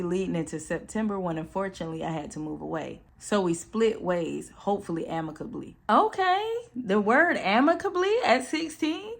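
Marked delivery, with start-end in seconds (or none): 5.9-9.1 very high-pitched, questioning reaction; impressed surprise. Real, not acted. Reacting to a sixteen-year-old's big word, surprised. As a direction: go way up in pitch, querying the fancy word. Teasing reaction to a teen's wording.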